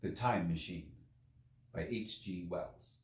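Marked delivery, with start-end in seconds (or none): none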